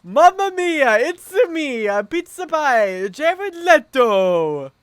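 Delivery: very high, loud Italian caricature; hammed-up Mamma mia chef; jolly Italian pizza chef. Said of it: "he was like this".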